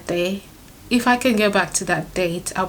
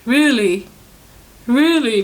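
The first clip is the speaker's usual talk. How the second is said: stunned disbelief; 4 semitones higher, 6 dB louder, slower than usual. Retold or imitated. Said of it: "And he was like".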